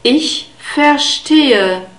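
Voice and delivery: slow, clear "ich verstehe" with a long e; careful German. Shown as a demonstration. Teacher modelling the phrase slowly for learners. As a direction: spell out each sound of "ich verstehe" slowly. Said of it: "the H itself is not spoken".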